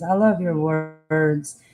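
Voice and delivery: ordinary delivery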